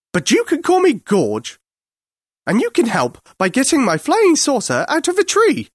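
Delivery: high, imperious alien; rude alien. A narrator's reading. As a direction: boss him about as a high-pitched Martian. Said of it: "The thing beeped in surprise".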